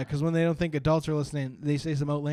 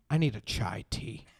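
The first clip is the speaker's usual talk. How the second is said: put-on slow deadpan kid; 6 semitones lower, 4 dB quieter, slower than usual. Kid's deadpan request.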